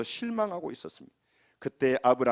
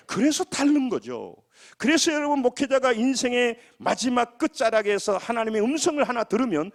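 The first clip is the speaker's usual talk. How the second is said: impassioned preaching; 11 semitones higher, 6 dB louder than usual. Real, not acted. Pastor assuring congregation God's voice raises the dying, impassioned preaching. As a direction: preach it high with urgent conviction.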